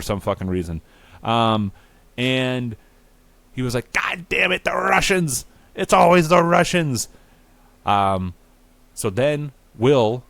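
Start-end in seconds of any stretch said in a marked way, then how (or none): 3.9-7.1 high, loud hammed-up rage; furious cursing. Will learning the contest was fake, mock fury. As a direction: rail at them in a strained high voice.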